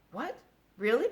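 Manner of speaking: loud hopeful surprise; crying out. A narrator's reading. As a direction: leap at the hope. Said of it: "cried Wilbur".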